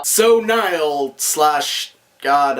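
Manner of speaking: drawn-out wry naming; slow deliberate. Real, not acted. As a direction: linger on each word dryly.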